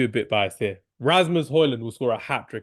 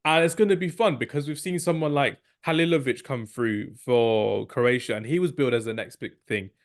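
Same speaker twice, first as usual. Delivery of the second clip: ordinary talk, nothing marked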